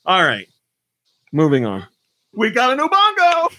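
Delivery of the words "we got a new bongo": high, delighted squeal; excited squeal. Real, not acted. Host thrilled about a fan-made Ubongo board, squealing high. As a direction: squeal it out with glee.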